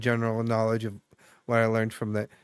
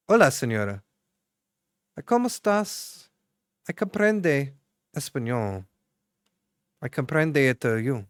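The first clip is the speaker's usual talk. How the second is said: Spanish greeting. Shown as a demonstration. Surprising eavesdropping strangers, greeting them in playful Spanish. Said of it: "basically say it in Spanish".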